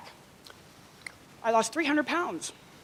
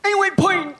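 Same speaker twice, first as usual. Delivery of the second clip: put-on higher, louder childlike demand; imitating brother; 8 semitones higher, 6 dB louder than usual. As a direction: whine it like a big kid. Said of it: "he goes".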